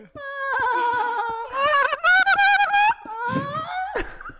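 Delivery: high squeaky dolphin chatter; dolphin squeal. Pretend. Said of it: "I can use my dolphin voice".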